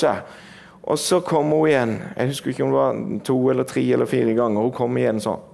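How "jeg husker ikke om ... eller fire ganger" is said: usual speaking voice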